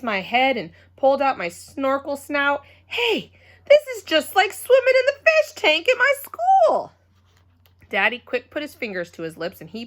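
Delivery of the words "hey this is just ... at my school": much higher, loud thrilled shout; loud excited. Acted. Junie B shouting to her parents in the water, thrilled. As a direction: burst out with delight. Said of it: "I tried to calm my voice, only it kept on staying loud".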